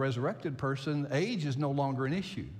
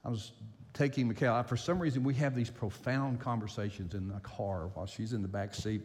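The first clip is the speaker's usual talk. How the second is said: ordinary talk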